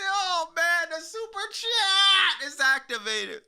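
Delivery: high, slow, stretched-out shout; hammed-up announcer cry. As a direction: holler it like a big hype announcement. Announces super chat with fanfare.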